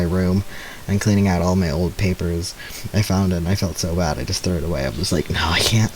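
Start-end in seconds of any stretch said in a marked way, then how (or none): 5.3-5.9 whisper. Retold or imitated. Retelling throwing the paper away.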